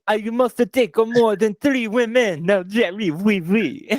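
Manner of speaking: high mock French talk-show guest; French accent. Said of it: "that dude from Jerry Springer".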